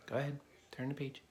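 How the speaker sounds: plain reading voice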